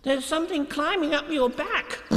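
high, excited Piglet warning; urgent small-creature alert; crying out. Acted. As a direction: blurt it in a squeaky little voice. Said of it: "he cried".

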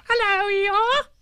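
very high, loud excited squeak; little Roo squeaking at his first party; squeaky Roo. A narrator's reading. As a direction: squeak it like a tiny joey. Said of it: "he squeaked".

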